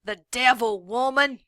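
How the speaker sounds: brand her a devil at full volume; furious denouncing shout. A narrator's reading. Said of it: "Trout shouted".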